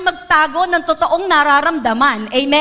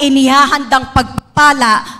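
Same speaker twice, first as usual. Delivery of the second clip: louder preaching, Jesus' promise driven home; preaching at full force; 4 dB louder than usual. Acted. Preacher proclaiming Jesus' promise to the church, loud and fervent.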